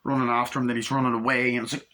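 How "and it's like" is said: ordinary delivery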